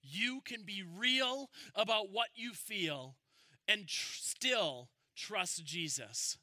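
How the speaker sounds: fervent pulpit voice; full-force preaching. Acted. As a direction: drive it home to the congregation. Closing exhortation to the congregation.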